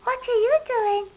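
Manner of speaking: high, curious question; curious hedgehog. A narrator's reading. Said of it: "shouted Little Hedgehog".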